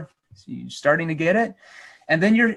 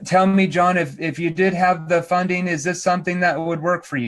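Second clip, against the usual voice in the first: robotic; 2 semitones higher than usual. Shown as a demonstration. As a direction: recite it like a robot, no pauses. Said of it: "You can't sound like a robot".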